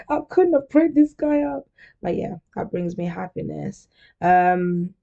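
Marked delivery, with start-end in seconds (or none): 0.1-1.7 much higher, louder swooning; overjoyed swoon over boyfriend; delighted gush. Retold or imitated. Gushing about boyfriend's texts.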